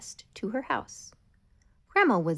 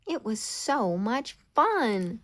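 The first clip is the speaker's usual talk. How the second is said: usual speaking voice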